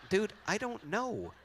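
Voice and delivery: high-pitched comic bafflement; exasperated mock protest; baffled reply. Acting baffled reply to friend.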